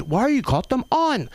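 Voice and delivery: high, curious mock voice; nosy question. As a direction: imitate nosy strangers. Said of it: "people are always".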